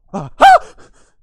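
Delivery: sudden loud high shriek; startled scream. Acted. Other guard scares him at the cave, startled shriek. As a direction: shriek as if jumped from behind.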